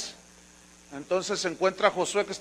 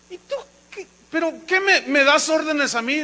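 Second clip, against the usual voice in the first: high, indignant scoff; how dare he order me; scornful retort; 8 semitones higher, 5 dB louder than usual. Acted. Pharaoh scoffing indignantly at Moses' commands.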